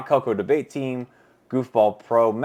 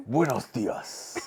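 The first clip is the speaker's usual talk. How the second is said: 1 hammed-up Spanish accent; 3 semitones higher, much slower than usual. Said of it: "That's the only way I think it's gonna sound right".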